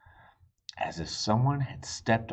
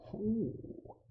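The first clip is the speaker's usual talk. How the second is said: soft ooh; 13 semitones higher, 8 dB quieter than usual. Acted. Reacting to mouth-full rule.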